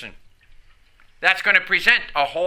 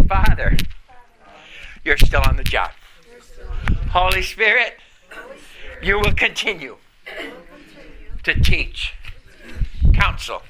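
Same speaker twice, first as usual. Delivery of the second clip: led prayer; 5 semitones lower than usual. Shown as a demonstration. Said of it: "Close your eyes. Say".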